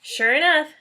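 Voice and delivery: ordinary delivery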